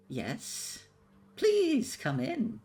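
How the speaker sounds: high-pitched hag luring guests in. Acted. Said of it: "you see this hag".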